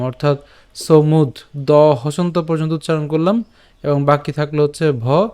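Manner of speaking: ordinary talk, nothing marked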